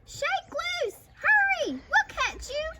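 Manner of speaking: high-pitched, frantic calls; shouted urging. A narrator's reading. Prairie dogs promise a catch, shouting. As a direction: urge the captive on from below. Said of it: "the prairie dog shouted".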